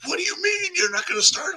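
high-pitched, incredulous caller imitation; mock indignant caller. Radio host imitating callers about a draft pick, mock indignant.

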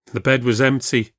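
usual speaking voice

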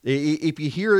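ordinary talk

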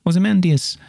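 high, loud mock-everyday delivery; exaggerated normal voice. Shown as a demonstration. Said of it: "that'd be, that'd be normal".

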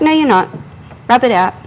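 mock-insistent retold order; arguing back. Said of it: "stood there and argued with her".

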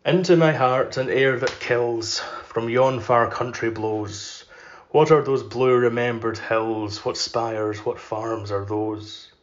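slow, measured poetry reading; poem recitation. Acted. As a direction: let each line of verse hang in the air.

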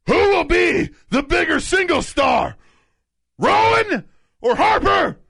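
booming, slow, high-pitched yelling; shouty announcer read. Pretend. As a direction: bellow every word like a hype man. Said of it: "I like to give him a little bit of a personality".

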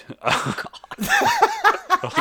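high-pitched burst of laughter; real high squeal of a laugh. Real, not acted. Laugh after people died.

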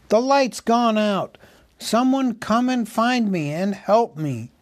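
high-pitched, pleading call; small cries for help. A narrator's reading. Hobbit begs the dwarves to find him, high panicked cry. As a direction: cry out helplessly to distant friends. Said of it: "Faintly the dwarfs heard his small cries".

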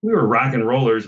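ordinary talk

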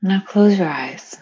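unhurried meditation voice with long pauses; guided meditation. Acted. Meditation leader inviting friends to picture flowing water, calm and spacious. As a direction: guide them gently, phrase by phrase, leaving space.

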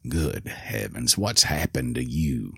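put-on old man startled by his own reflection; inner thought, hushed. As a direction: say it to yourself, barely aloud. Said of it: "he thought".

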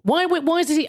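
high, incredulous outburst; exasperated disbelief. Retold or imitated. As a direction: act stunned and fed up at once. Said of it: "I'm going".

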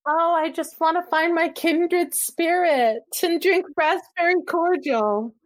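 dreamy, high, drawn-out mock longing; slow, lifted voice of a wistful romantic heroine; Anne of Green Gables. Friend teases her otherworldly pal, dreamy high mimicry. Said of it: "Anne of Green Gables".

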